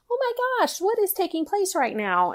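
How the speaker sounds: high, amazed inner voice; bewildered. Retold or imitated. Half-asleep mom reacting to the news, high and amazed. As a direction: still groggy and baffled.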